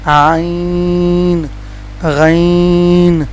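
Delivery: "عین" and "غین" very slow, vowels held; correct ain, ghain. Shown as a demonstration. Teacher models for learners, "عین غین" greatly drawn out. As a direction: stretch "عین", then "غین", from the throat. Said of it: "لیکن اس کو پڑھیں گے".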